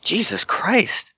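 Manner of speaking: high breathless whisper of disbelief; real astonished whisper; stunned whisper. Real, not acted. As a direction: gasp it at the lucky roll. Player sees big dice roll.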